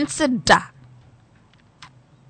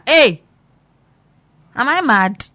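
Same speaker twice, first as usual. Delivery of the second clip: loud, indignant outburst; outraged shout; 3 semitones higher, 8 dB louder, slower than usual. Real, not acted. Vlogger reacting to devoted-wife videos, loud outrage. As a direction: shout it, disbelieving.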